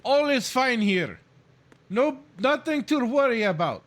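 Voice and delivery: hammy fake Russian accent for a game guard; bad Russian accent. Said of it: "bad Russian accent".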